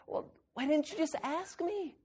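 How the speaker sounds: high, very soft, amazed question; a father baffled his child never asked. Retold or imitated.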